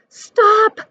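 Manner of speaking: high shrill scream of protest. A narrator's reading. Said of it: "screamed his guests".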